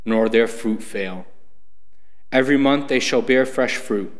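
ordinary talk, nothing marked

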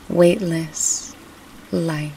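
ordinary delivery

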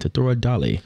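lightly put-on English accent; mock English accent. Shown as a demonstration. Trying Irish for his co-host but landing English, in an English accent. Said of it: "That's an English accent".

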